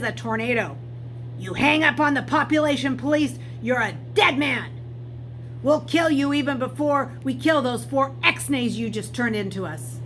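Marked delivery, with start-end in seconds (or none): 1.4-10.0 loud, menacing threat; angry threat. Acted. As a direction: bark the threat down the phone. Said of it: "The angry voice on the other end".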